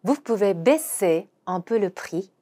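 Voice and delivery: very slow French; slow teaching read; slow demo pronunciation. Shown as a demonstration. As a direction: model each word slowly. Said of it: "발음을 한번 해보도록 하겠습니다".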